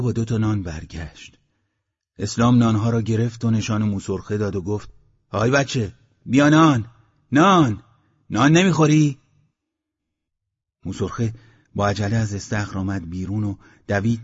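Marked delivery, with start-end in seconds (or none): 5.3-9.2 loud, high, short calls; calling out enticingly. A narrator's reading. Villager shows bread to the creature, calling out. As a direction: lure someone out with food.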